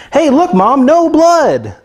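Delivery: put-on high, loud kid-like cheer; hammed-up childish brag; excited shout. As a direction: show off to mom like a proud kid. Proud kid showing off to mom.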